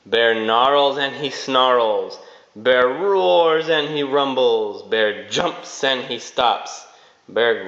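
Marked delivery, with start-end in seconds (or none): none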